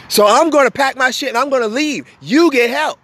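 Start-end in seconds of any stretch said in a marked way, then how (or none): none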